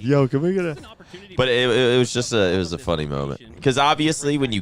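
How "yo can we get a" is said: high eager fan voice; drunk eager fan. Retold or imitated. Drunk fan asks for a photo, imitated high eagerness. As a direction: mimic a fan begging for a picture. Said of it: "he was fucked up".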